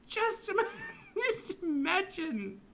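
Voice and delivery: high laughing, slowed words. Real, not acted. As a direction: break into giggles mid-word. Laughing at the blanket.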